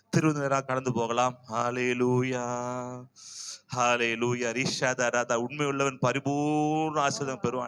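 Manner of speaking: low-pitched, earnest congregational prayer. Real, not acted. Preacher leads congregation into communion, praying low and earnest. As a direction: pray in a low, measured chant to God.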